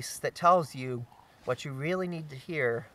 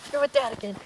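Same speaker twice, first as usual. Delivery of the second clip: repeated higher and louder to the dog; high dog-talk voice; 10 semitones higher, 4 dB louder, faster than usual. Real, not acted. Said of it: "talking to the dog".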